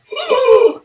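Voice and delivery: high startled gasp. Retold or imitated. Comic hero sees the fire bottle, sharp gasp. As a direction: gasp in shock. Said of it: "And he's like".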